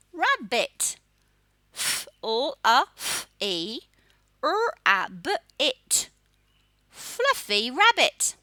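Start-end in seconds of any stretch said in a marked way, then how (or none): none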